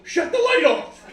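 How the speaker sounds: hammed-up high ranting father; loud high mock dad rant; grumbling dad. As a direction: rant like a cheap dad around the house. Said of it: "walking around the house going".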